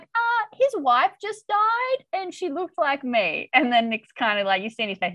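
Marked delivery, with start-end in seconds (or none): 0.0-3.5 high, slow wounded reply; voicing Tess, pitched up and stung; slow, high mock hurt; hurt retort. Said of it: "Tess is like".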